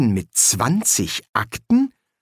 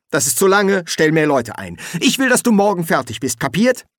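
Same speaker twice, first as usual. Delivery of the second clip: high, brisk, impatient orders; pushy, raised-pitch commanding; bossy demand; 7 semitones higher, 4 dB louder than usual. Acted. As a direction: lay down the law to an underling. Boss orders nephew to hurry.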